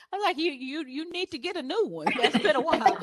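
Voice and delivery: laughing through the words. Real, not acted. Photographer teasing a client, laughing. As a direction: chuckle while teasing.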